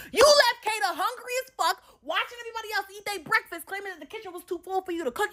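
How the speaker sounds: high, heated complaint; irritated rant. Real, not acted. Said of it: "it pissed me off all over again".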